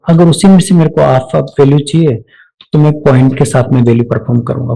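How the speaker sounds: ordinary talk, nothing marked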